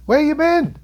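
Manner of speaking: loud, high-pitched mock disbelief; incredulous call-out. Reviewer chiding YouTubers who never heard the buzz, incredulous shout. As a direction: throw it out high and loud at someone clueless.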